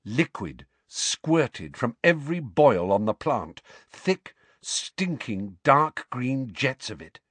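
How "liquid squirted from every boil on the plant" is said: ordinary delivery, nothing marked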